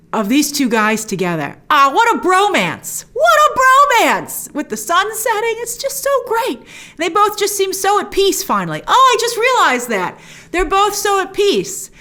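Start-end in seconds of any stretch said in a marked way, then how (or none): 1.7-3.1 slow, fond gush; a touch of tenderness. Real, not acted. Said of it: "all teary-eyed and choked up". 3.1-4.5 much higher, louder and very slow; gushing swoon; overwhelmed gush. Real, not acted. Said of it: "all teary-eyed and choked up". 4.5-11.9 moved fan; touched. Real, not acted. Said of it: "all teary-eyed and choked up".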